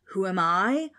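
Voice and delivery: loud, affronted question; indignant ghost. A narrator's reading. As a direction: throw the question back indignantly. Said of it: "roared the ghost".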